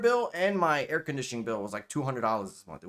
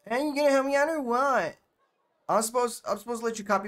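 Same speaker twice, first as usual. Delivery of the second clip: high, loud put-on girl voice; high-pitched mock teen girl demanding; demanding schoolgirl; 10 semitones higher, 4 dB louder than usual. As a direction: push it up high, pestering like a schoolgirl. Same schoolgirl demanding help.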